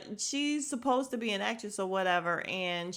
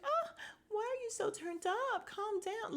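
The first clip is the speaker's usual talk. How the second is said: high, softened fake-innocent voice; mock-dramatic high-pitched soothing; bad acting; 13 semitones higher, 4 dB quieter than usual. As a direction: overact being taken aback, high and soft. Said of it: "Drew is just acting and it's terrible".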